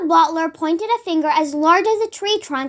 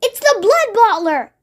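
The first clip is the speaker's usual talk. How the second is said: higher, urgent warning; alarmed; 6 semitones higher than usual. Acted. BFG warning Sophie, alarmed. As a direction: blurt out the danger. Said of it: "cried the B F G".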